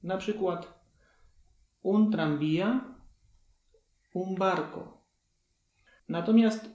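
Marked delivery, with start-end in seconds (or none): 1.8-5.0 "un tranvía, un barco" with a hard Polish-like b; teacher's example words; Spanish demo. Shown as a demonstration. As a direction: model each word clearly. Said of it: "B wymawia się jak polskie B".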